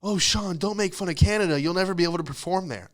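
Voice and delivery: high-pitched mock pleading; fretful objector. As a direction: whine a warning as a worried fan. Voices a worried objector to himself.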